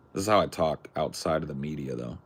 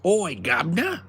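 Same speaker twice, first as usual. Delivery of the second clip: high, loud cockney chimney sweep greeting; hammed-up cockney, pitched way up; mock cockney accent; 13 semitones higher, 5 dB louder than usual. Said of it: "every last one of 'em was a chimney sweep".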